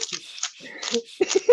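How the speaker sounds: loud shushing. Real, not acted. Teacher shushing noisy students, loud shh. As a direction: hush the class down. Hushing the class.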